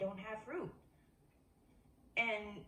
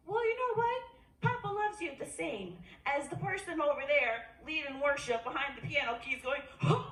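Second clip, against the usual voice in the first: loud, higher, full-force preaching; 4 semitones higher, 4 dB louder than usual. Real, not acted. Preacher telling the congregation Papa loves them, loud and high. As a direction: lift it and proclaim. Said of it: "preaching at full force".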